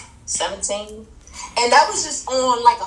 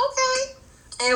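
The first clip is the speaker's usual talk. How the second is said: shy acceptance; 13 semitones higher than usual. Retold or imitated. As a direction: play the bashful guest going along. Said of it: "Don't be shy".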